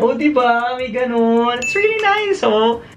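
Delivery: high, bright praise; delighted, pitched high; excited showing off. Real, not acted. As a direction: show it off with glee. Showing the lit-up gift proudly.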